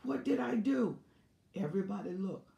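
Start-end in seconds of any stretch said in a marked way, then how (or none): 0.0-1.0 staged higher-pitched wail; mock scream. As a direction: cry out "why" as if wronged. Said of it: "I will scream out".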